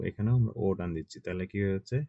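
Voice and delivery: ordinary talk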